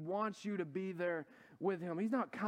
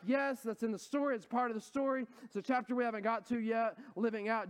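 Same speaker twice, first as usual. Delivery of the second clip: normal talking voice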